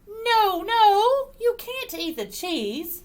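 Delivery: high, loud motherly no; high-pitched mother objecting; mother forbidding. Acted. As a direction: forbid the child firmly, high. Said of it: "said Mother".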